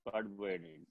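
very soft, low mock lament; acted mourner's murmur. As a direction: play the weeper answering low and faint. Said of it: "నువ్వు ఏడవడం ఎందుకురా". (ఏడ్చేవాడిలా నటిస్తూ తగ్గు స్వరంలో, మెల్లగా)

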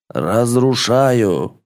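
slow, majestic drawn-out command. A narrator's reading. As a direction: stretch it out like a spell. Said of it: "Величественный голос Бельфидора нарастал".

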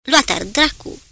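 exclaimed curse. A narrator's reading. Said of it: "dar exclamă". (blestem exclamat reținut, pe ton mai înalt)

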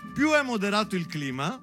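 ordinary talk

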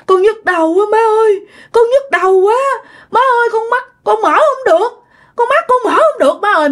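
high-pitched acted wail of pain; pained moaning.

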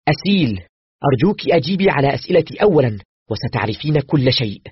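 pushing hard, out of patience; impatient. A narrator's reading. Doctor to Aseel, insisting she answer first, impatient. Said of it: "زفرت بقوة وأنا أقول بنفاد صبر".

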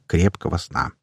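ordinary delivery, nothing marked

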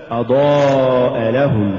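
long أضاء, kept apart from لهم; separate words. Shown as a demonstration. Teacher repeats the correct form, أضاء stressed apart from لهم. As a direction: stress أضاء as its own word, then لهم. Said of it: "الصواب".

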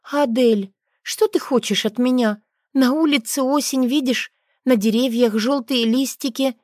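put-on a mother reasoning with a tearful girl; patient mother. A narrator's reading. Mother explaining to child. (терпеливая мама)

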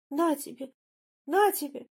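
high-pitched angry outburst; angry scolding. A narrator's reading. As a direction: scold in a high voice while pummelling him. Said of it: "ругалась Мария Аркадьевна". (сердито ругаясь, высоким голосом)

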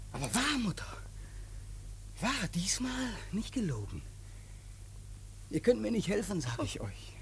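soft, low whispered threat; menacing whisper. Acted.

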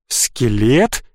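low, rising incredulous question; stunned. A narrator's reading. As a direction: echo the word in disbelief. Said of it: "Я никак не мог собраться". (растерянно переспросить)

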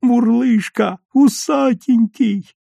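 very high, delighted squeals; squealing. A narrator's reading. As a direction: shriek with joy, rising to the top. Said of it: "взвизгивал Серёжа".

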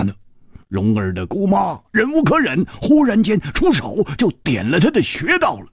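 put-on high, indignant complaint about the girl; resentful. Hu Tiehua venting his resentment of Jiu Mei to his friend. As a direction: spit out the grudge against the fierce girl. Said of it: "他恨恨地接着说".

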